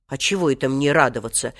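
ordinary delivery, nothing marked

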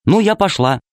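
put-on high girlish voice, light; girl's voice. A narrator's reading. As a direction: play a little girl heading out. Alice leaves. (голос девочки)

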